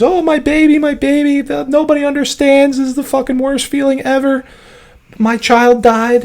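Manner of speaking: high, mock-wailing grieving mother. Storyteller voicing the bereaved mother, high mock wail. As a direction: ham up a sobbing mother's lament.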